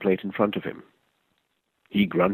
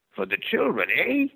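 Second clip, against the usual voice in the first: put-on much higher, the old man echoing with a question; old man's voice; 11 semitones higher than usual. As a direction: turn it back on him as the old stranger.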